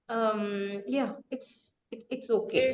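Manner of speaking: soft hesitant okay; unsure, trailing quietly; uncertain. Shown as a demonstration. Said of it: "you are uncertain".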